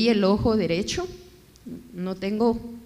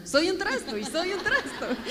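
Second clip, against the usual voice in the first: laughing; 9 semitones higher than usual. Real, not acted. Laughing at her own mistake.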